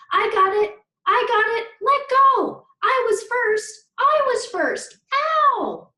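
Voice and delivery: high, loud squabbling shouts; frantic playground tug-of-war cries; kids shouting. Acted. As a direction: yell over each other grabbing the hat. Kids fighting over the hat.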